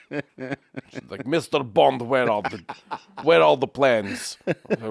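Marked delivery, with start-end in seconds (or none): 1.3-4.4 put-on Russian spy-film villain accent; hammed-up Russian accent. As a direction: interrogate slowly in a heavy Russian accent. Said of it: "an accent that was so Russian".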